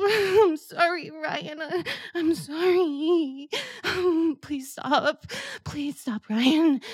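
tearful apology. Acted. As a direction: beg forgiveness through tears. Roleplay, mother apologising, crying.

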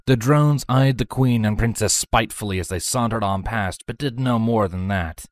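plain reading voice

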